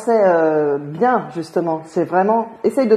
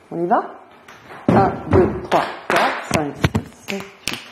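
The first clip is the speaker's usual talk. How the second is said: ordinary delivery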